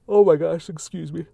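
talking through a yawn. Real, not acted. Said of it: "I swear I'm not bored, I'm just tired".

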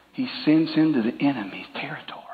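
hushed, breathy aside; whispered warning to the congregation. Real, not acted. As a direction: drop to a secret whisper.

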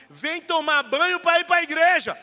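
loud, slow call from the doorway; mother calling. Retold or imitated. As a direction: call the kids in like a mother at the door. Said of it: "sua mãe ia na porta e falava assim".

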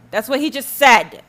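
indignant loud insistence. Real, not acted. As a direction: drive it home loudly. Louder repeat.

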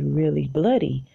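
ordinary talk, nothing marked

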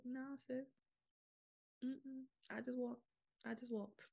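high, soft mock-winded reply; small high voice, pausing for air; acted-out breathlessness. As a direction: imitate being winded on the phone, in short bits. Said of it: "And I was out of breath".

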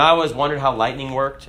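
ordinary talk

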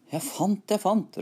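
high, eager cry of discovery; excited calling out. A narrator's reading. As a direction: call it out high to your companions. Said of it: "ropte han".

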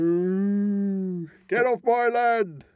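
lightly voiced angry farmer shout; moo, then yell like a farmer; cow and farmer impression. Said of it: "was that a cow?".

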